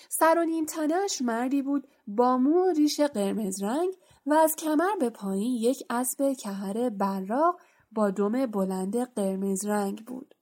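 ordinary delivery, nothing marked

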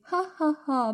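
high, spelt-out "ha ha ha"; gloating, acted cackle at the victim; mocking laugh. A narrator's reading. Poltergeist gloats over tricking Filch. (خندهٔ تمسخرآمیز با صدای زیر)

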